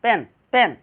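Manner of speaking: "पेन, पेन" each with a short e; two quick "पेन", no stretching; quick short vowel. Shown as a demonstration. Teacher repeats the right reading, short "पेन". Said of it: "तेज़ी से पढ़ना है".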